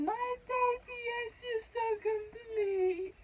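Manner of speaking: high, stretched mock-crying squeal; emotional. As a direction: wail it very slowly, imitating tearful joy. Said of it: "I'm so emotional I keep saying".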